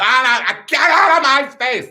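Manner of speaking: high, loud camp squawk; fending-off shriek. Acted. Queen scratching someone's eyes out, camp shriek.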